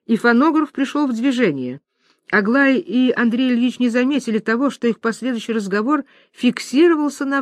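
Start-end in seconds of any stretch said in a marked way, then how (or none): none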